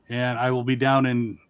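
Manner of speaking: ordinary talk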